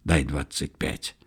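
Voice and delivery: low, soft confidential request; hushed aside; whispered aside. A narrator's reading. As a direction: drop pitch and volume, just between two. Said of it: "шепнул Карасю".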